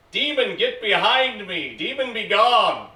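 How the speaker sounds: loud, high mocking exorcism; hammed-up shouted banishment; mock exorcist shout. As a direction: bellow like a zealous exorcist, in mockery. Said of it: "you could shout".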